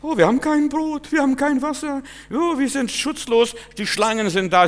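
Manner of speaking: mock the complaining people crying out in the desert; wailing Israelites. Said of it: "die Kinder Israel schrien".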